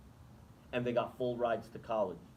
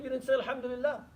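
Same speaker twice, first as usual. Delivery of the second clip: much higher, set-apart voice for the Prophet's reply; quoting the Prophet; 11 semitones higher, faster than usual. Retold or imitated. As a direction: lift the voice high to voice the Prophet's answer. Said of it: "He said".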